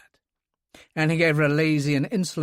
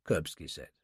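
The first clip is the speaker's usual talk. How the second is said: ordinary delivery, nothing marked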